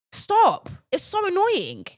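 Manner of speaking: high-pitched exasperated whine; plead, high and fed up; exasperated plea. Real, not acted. Fed-up vlogger telling complainers to quit, high exasperated whine.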